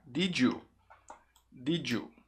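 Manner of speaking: "Did you" run together as "didju"; linked D-J sound. Shown as a demonstration. Teacher shows the common connection, "Did you" as "didju". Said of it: "a pronúncia vai ficar".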